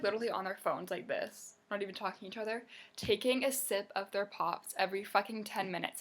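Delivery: ordinary talk, nothing marked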